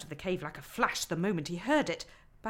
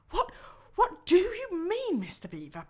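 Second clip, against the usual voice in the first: panting; 10 semitones higher, 7 dB louder than usual. Acted. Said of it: "panted Peter".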